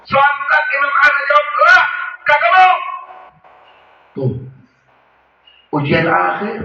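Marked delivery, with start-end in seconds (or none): 1.6-2.8 higher, louder refusal; stubborn refusal. Retold or imitated. Dying imam refusing his son's prompting, stubborn. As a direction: blurt it out as a dying old man.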